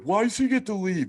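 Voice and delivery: high-pitched mock complaint; yelling kids. Other prisoners complaining he gets released, mild mock yell. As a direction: imitate kids protesting, without real volume. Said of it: "the other kids started yelling".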